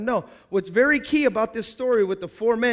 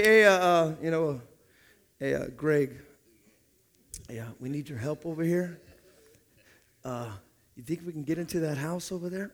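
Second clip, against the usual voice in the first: much softer, hesitant pastor's request; pastor asking quietly; 6 semitones lower, 6 dB quieter than usual. Pretend. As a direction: ask for help almost under your breath. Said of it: "if I were to say".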